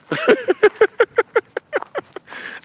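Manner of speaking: high cackling laugh; gloating laugh. Real, not acted. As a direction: cackle in triumph. Gloating over viewers.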